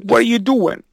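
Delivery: high-pitched comic impression; Tracy Morgan. Showing off a rusty celebrity impression, high comic voice. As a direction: pitch it up into a rough comedian impersonation. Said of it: "pretty good Tracy Morgan".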